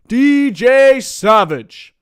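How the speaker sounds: slow, booming ring announcer call of the name D J Savage; drawn-out hype call. Pretend. Streamer shouting out a viewer, booming drawn-out announcer call. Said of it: "Thank you, D J Savage".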